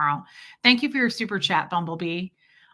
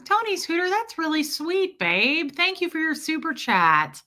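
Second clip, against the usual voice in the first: high sweet voice; 7 semitones higher than usual. Real, not acted. High, touched thanks to a viewer.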